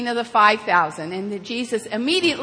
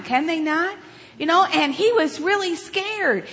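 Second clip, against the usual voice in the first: usual speaking voice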